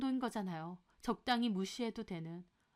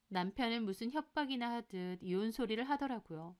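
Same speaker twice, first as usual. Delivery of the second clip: ordinary delivery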